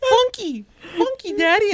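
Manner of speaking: put-on high, loud insistent child voice; imitated little daughter. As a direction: mimic a kid correcting Dad. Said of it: "she tried to call my son a funky nerd".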